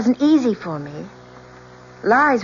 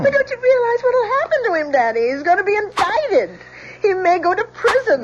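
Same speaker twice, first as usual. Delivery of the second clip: anguished plea; 8 semitones higher than usual. Acted. Daughter pleads with father.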